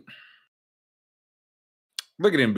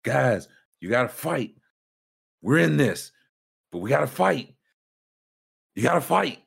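higher, pleading huddle plea; mock coach pleading in the huddle; imitating Doc Rivers; 5 semitones higher than usual. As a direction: beg the team to fight, a bit higher. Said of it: "he's like".

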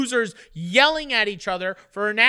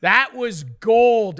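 slow, drawn-out and louder on gold; mock outrage; 5 dB louder, much slower than usual. Real, not acted. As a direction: stretch "gold" like a wounded complaint.

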